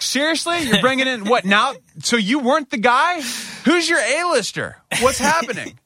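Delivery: exasperated falsetto outrage; a parent who can't believe another try; incredulous. Retold or imitated. Exasperated retelling of hospital staff.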